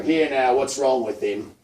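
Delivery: loud, official challenge; rabbit policeman. Acted. Storyteller as rabbit police finding Fiver, officious.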